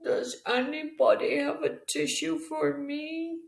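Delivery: high little flea voice pleading; garbled plea. Acted. The flea begging the farm animals for a tissue, high pleading voice. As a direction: plead like a tiny stuffy-nosed flea. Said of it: "his garbled plea".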